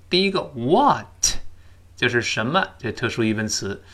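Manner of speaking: usual speaking voice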